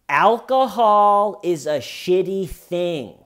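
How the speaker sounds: high, loud, drawn-out pronouncement; mock dramatic declaration. Suspect blames alcohol after a pause, mock declaiming. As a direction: proclaim it slowly in an overblown imitation.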